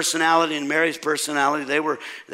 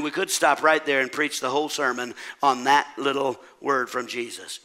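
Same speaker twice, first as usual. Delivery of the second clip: normal talking voice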